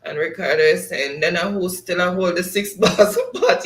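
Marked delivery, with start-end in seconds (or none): 1.2-3.6 louder, laughing through a chat line. Real, not acted. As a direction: read it while cracking up. Reading chat, cracking up.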